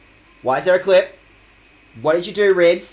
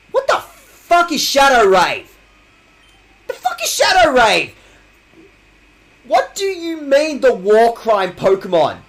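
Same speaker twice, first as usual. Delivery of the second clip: higher, louder, slow stretched disbelief; outraged yell; 6 semitones higher, 5 dB louder, slower than usual. Real, not acted. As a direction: drag each word out in outraged shock. Outraged at Shadow Rave.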